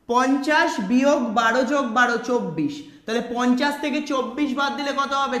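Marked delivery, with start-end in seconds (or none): none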